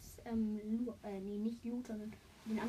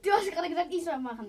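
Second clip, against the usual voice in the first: indignant outburst; 7 semitones higher, 12 dB louder than usual. Real, not acted. Arguing about choosing a character.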